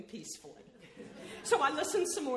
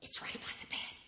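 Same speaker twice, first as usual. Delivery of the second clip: whispering; 5 dB quieter than usual. Acted. Whispered in dark tent.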